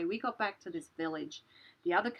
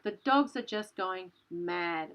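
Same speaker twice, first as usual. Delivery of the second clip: ordinary delivery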